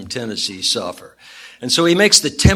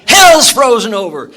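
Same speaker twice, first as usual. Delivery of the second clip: much higher and louder, shouting for joy; triumphant yell; 14 dB louder than usual. Acted. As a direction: yell it across the frozen field.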